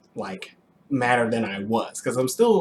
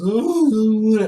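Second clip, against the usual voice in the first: whiny wife imitation; 10 semitones higher, 7 dB louder than usual. Wife balks at opening account.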